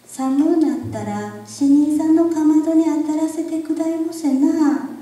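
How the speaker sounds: put-on high sing-song girl voice; a child asking sweetly; young girl voice. A narrator's reading.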